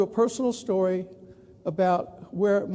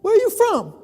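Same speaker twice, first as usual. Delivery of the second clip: imitated Texan; 12 semitones higher, 6 dB louder than usual. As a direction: mimic a nosy Texan, pitched up. Texans questioning a newcomer.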